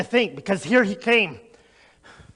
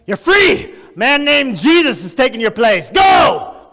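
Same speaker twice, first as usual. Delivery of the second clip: high, loud shouted release; guards' order; 5 semitones higher, 4 dB louder than usual. Retold or imitated. Soldiers telling Barabbas that Jesus takes his place, shouted. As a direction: call it out as a soldier freeing him. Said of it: "And they said".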